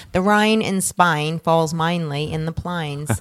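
put-on lightly done Cockney accent, slowed; mock Cockney, drawn-out vowels on Spine and plines; a hint of a Cockney accent, slow and playful. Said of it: "my British Cockney accent".

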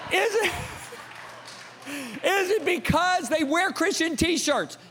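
very high, incredulous-sounding challenge; escalating rhetorical grilling; dad grilling sons. Retold or imitated. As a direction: push the question way up high. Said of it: "I said".

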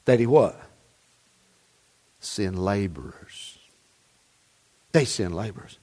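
quiet earnest sermon voice; soft intimate preaching. Acted. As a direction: bring it down close and earnest.

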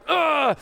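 loud exasperated groan; frustrated ugh. Retold or imitated. Pastor venting frustration at political hate, exasperated groan. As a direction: groan it out in disgust.

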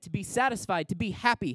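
usual speaking voice

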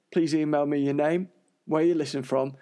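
ordinary talk, nothing marked

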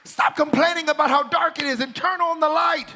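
loud, high-pitched shouted exhortation; fervent pulpit cry, stretching key phrases; full-force preaching. Real, not acted. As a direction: build to a shouted command.

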